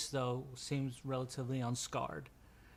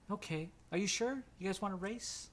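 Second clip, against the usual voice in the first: put-on high-pitched kid's challenge; high little-boy voice; young boy; 7 semitones higher than usual. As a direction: dare them to race as a small boy. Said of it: "the little kid".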